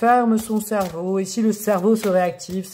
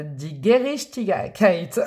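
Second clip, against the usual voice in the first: very slow, deliberate German; German sounded out syllable by syllable; German accent; much slower than usual. Real, not acted. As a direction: labour through the long German word. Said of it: "ça me fait réviser mon accent allemand".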